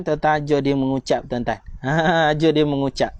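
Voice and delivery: ordinary talk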